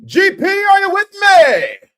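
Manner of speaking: high, loud hype shout; fan shout. Retold or imitated. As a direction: yell it like an excited fan hollering. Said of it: "be like".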